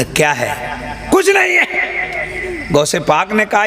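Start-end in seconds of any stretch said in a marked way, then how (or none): 0.0-1.1 drawn-out deep "क्या है"; slow low child's question. Retold or imitated. Child asks what is inside. 1.1-2.7 high, slow drawn-out denial; bearer's refusal. Retold or imitated.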